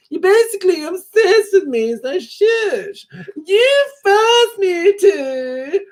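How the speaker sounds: high whiny squabbling sister voice; mock teen sister spat, shrill and teary; impression of sisters bickering. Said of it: "My impressions of the Coffey family".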